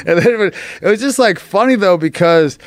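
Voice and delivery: high-pitched, talking through laughter; real amusement lifting the voice high; laughing. Real, not acted. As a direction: still cracking up while trying to go on. Still laughing after recalling the line.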